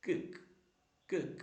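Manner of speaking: ordinary talk, nothing marked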